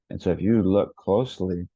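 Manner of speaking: normal talking voice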